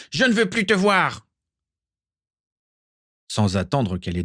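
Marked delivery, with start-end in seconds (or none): 0.1-1.3 high, furious dismissal; angry roar. A narrator's reading. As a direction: cry out in rage to banish someone. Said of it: "rugit-il à nouveau". (cri de rage aigu)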